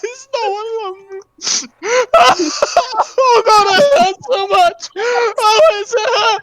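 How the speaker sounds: hammed-up sobbing wail, very high; mock crying. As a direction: wail like a heartbroken drama queen. Mock heartbreak, fake crying.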